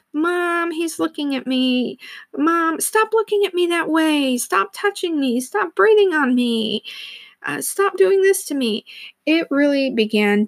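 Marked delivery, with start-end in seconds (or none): 0.1-8.8 whiny tattling little sibling. Retold or imitated. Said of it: "it was".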